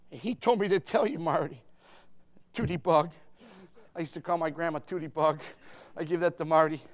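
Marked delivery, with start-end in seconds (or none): none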